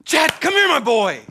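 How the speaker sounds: high, loud welcoming call; a father calling his son over, loud and glad; fond dad calling. Retold or imitated. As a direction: call your boy over with joy. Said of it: "he's the dad".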